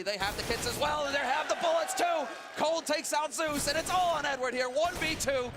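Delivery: rising excited play-by-play shout; esports caster. Real, not acted. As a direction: build to a screaming clutch call. Clutch round play-by-play.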